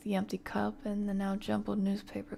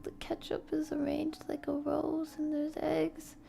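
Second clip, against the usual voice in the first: excited listing; 7 semitones higher, 4 dB louder than usual. Acted. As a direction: pile on the delight item by item. Marvels at the big breakfast.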